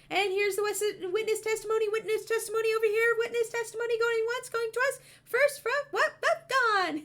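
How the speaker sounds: fast, high auctioneer patter. Pretend. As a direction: rattle it off like an auction. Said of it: "Everyone would have to talk like an auctioneer".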